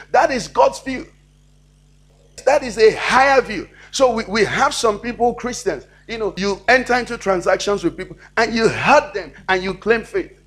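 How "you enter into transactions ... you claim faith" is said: ordinary delivery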